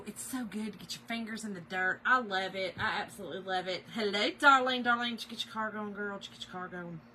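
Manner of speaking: ordinary talk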